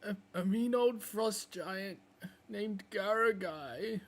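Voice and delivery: high, slow, whimpering plea for help; pleading hurt wolf. Acted. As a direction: beg like a wounded young wolf. Wolf answers the questioning.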